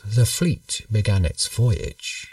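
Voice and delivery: usual speaking voice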